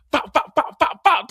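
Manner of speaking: high, loud pops like gunfire; mimicked gunshots. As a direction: spit the pops out like shots. Gunshots at Tommy's feet.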